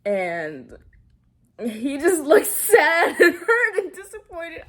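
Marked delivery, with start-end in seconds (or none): 1.6-4.6 high, loud, laughing through the words. Real, not acted. Recapper telling viewers about the sad husband, laughing. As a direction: crack up while telling it. Amused retelling of a sad scene.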